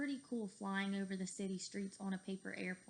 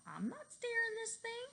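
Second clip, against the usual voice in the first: high excited protest; 13 semitones higher than usual. Acted. Denying steering the plane.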